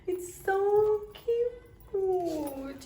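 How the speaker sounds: high, slow squeal; excited gushing. Real, not acted. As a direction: stretch it out in delight. Drawn out gushing.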